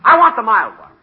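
loud, high frantic outburst; exasperated shout. Acted. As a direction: yell back to silence the nagging. Jack yells back at temptation.

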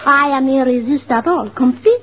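hammed-up French vampire seductress; French accent. Friend shows Mister Hamill Tootsie's wicked vampire, mock French accent. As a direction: put on a theatrical French accent, lilting up on each tag question. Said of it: "she does it with the French accent".

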